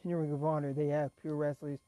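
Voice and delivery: ordinary delivery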